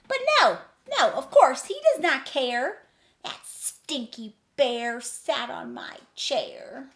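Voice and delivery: high, loud, sing-song rhyming read; dramatic storybook reading. A narrator's reading. Reading the picture book to children, dramatically. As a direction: read the rhyme big for kids.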